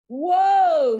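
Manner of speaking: high, loud exclaim; mock whoa. A narrator's reading. Harrison mocking reaction.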